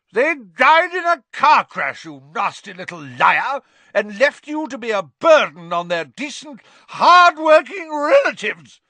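very high, loud, enraged tirade; furious scream. A narrator's reading. Aunt berating nephew over his parents, screaming. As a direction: scream down the liar in a rage. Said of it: "screamed Aunt Marge, swelling with fury".

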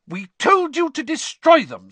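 furious, high and loud. A narrator's reading. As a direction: scold the twins in a fury. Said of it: "said Missus Weasley furiously".

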